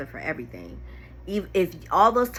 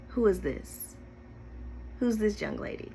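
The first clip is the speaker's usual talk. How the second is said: quoted cold question; 2 semitones higher, 8 dB quieter than usual. Retold or imitated. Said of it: "her exact words was".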